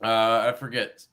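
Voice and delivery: normal talking voice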